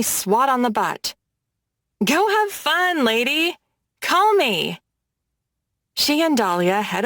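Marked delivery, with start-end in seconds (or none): 2.0-4.8 bright, higher parting call; cheerful call. A narrator's reading. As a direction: call out happily across the bar. Callie calls out cheerfully.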